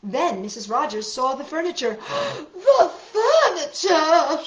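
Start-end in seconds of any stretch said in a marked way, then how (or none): 2.0-2.6 high, sharp gasp of shock. Acted. Missus Rogers seeing the furniture, shocked gasp. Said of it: "she cried". 2.7-4.4 loud, high, slow cry of dismay. Acted. Missus Rogers seeing the furniture, loud dismayed cry. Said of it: "she cried".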